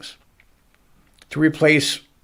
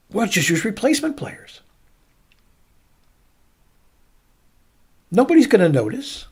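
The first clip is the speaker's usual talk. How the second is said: owners' scheme voiced higher; a higher, scheming owner voice; mock owners' voice; 9 semitones higher than usual. Host mocking the owners' plan, in a higher scheming voice. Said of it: "So the owners came up with this idea".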